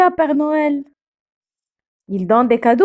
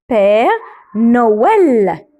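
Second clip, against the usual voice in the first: usual speaking voice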